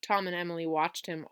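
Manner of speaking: plain reading voice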